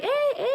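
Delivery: "Eh, eh?" pitched very high; questioning eh. Shown as a demonstration. Canadian showing how she says eh, high questioning "Eh, eh?". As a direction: nudge for agreement with high "Eh, eh?". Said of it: "that's how I say it".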